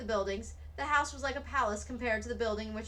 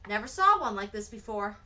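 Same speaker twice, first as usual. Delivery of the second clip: plain reading voice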